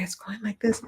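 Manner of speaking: whispered, as if feeling the train pass; awed whisper. Retold or imitated. Student describing his vision in an awed whisper. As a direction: breathe it out in wonder. Psychic describing target, awestruck.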